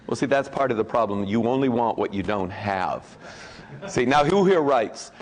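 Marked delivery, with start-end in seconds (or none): none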